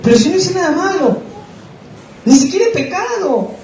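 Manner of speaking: self-mocking high whine of innocence; naive innocent protest. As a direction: play the foolish innocent defending himself. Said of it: "decía así, mira, la bobera de uno".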